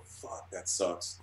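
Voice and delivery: whispered, slow and soft; slow whispered disappointment; muttered self-criticism. Retold or imitated. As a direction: breathe it out, deflated, under the breath. Artist judging own page.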